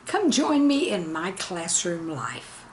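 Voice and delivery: ordinary delivery, nothing marked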